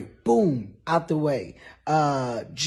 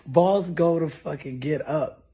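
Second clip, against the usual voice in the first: normal talking voice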